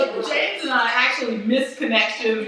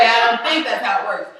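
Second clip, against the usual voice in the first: ordinary talk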